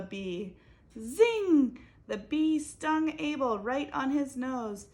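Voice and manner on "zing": loud high zing of a bee; zing sound. A narrator's reading. Narrator voicing the bee's sting, loud high zing. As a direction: zip the word out like a sting.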